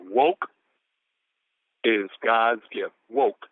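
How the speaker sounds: slow, deliberate words with long pauses; measured, spaced-out quote reading; real slow reading. Shown as a demonstration. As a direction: read it out slowly, letting each word land. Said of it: "I can read this real slow".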